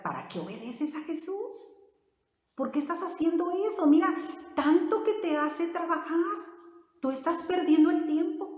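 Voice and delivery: a hint of a nagging devil; discouraging devil. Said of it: "oyó al diablo y le puso ese pensamiento".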